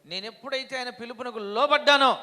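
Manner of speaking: fiery preacher shout; preaching. Acted. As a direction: cry it out to the congregation. Preaching burst.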